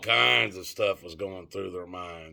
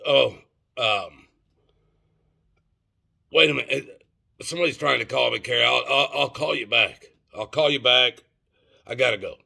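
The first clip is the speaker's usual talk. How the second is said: hurried, a bit louder, pretending another call is coming in; faked hurried interruption; 2 semitones higher, 4 dB louder than usual. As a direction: rush off the phone as if a call just beeped in. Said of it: "I acted like I was being interrupted by a phone call".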